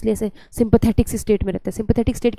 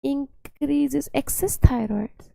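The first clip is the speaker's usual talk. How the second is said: very slow, spaced-out words; slow dictation; 3 semitones higher, 4 dB quieter, much slower than usual. Real, not acted. Teacher trying out a line for class notes, slow dictation. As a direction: dictate slowly while writing along.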